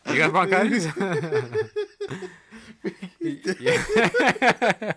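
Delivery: high, loud, laughing disbelief. Real, not acted. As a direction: laugh out the question.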